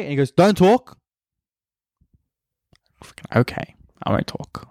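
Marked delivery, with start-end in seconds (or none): 0.3-1.0 loud, higher curt order; mock intimidating command; intimidating cop. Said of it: "He's trying to be intimidating". 3.0-4.7 whispered mock submission; hushed, acted-out compliance; cowed whisper. Cowed reply to intimidating cop.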